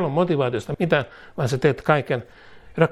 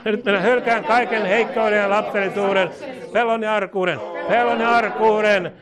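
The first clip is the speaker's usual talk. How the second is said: a slightly raised, steady leading voice; prayer declaration; 5 semitones higher than usual. Real, not acted. Preacher leading congregation in a declaration, proclaiming. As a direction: proclaim each line for others to echo.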